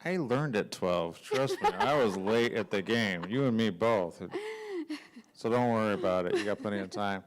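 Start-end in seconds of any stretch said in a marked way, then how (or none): none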